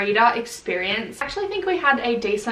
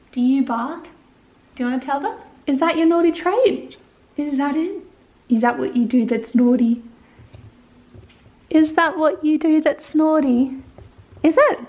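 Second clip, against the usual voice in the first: playful puppy talk; 4 semitones higher, 4 dB louder than usual. Real, not acted. Teasing the puppy about barking.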